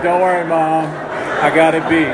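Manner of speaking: slow, loud, slurred mock confidence; drunk reassurance. Drunk son with change in his mouth reassures mother, slow and loud. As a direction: assure her grandly while obviously drunk.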